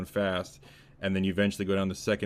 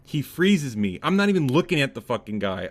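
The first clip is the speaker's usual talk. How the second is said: ordinary delivery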